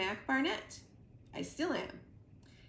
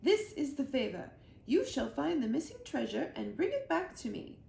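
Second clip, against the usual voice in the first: higher, louder commanding queen; 6 semitones higher, 3 dB louder than usual. A narrator's reading. The Queen commands Mac to find the treasure, regal. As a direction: give a royal order. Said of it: "said the queen".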